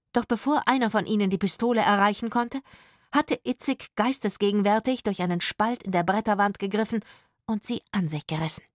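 ordinary delivery

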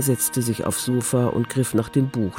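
usual speaking voice

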